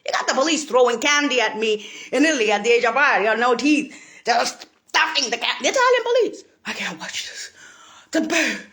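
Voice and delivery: ordinary delivery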